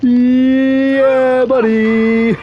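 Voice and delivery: squeaky high mock greeting; goofy high-pitched hello; high put-on voice. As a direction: call out in a silly high voice.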